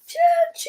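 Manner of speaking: high-pitched choo-choo; train whistle. Acted. Storyteller showing children how a train calls, high whistle. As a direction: hoot like a toy train, way up high. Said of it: "A train calls".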